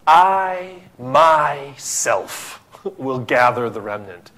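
I myself drawn out slow and weighty, stress on myself; God insisting he alone will gather them, slowly; emphatic God. Acted. Preacher voicing God's emphatic promise, slow and weighty. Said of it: "very emphatic".

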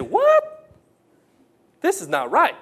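what jumps way up in pitch, loud; shocked bystanders cry out; mock outraged onlookers.

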